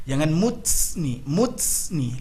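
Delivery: "muts" with a hissing ts; Indonesian: "muts" salah, berdesis; wrong muts. Shown as a demonstration. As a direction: demonstrate a faulty "muts". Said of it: "lari dari makhraj. Salah".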